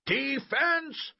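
loud, high-pitched coach's yell; drawn-out sideline shout. A narrator's reading. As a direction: yell it across the field at your players. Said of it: "Coach shouted".

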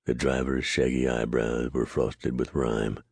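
usual speaking voice

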